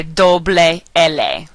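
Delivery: doble L said "DOH-bleh EH-leh"; Spanish letter name. Shown as a demonstration. Teacher giving another name for L L, careful Spanish pronunciation. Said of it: "you can also say".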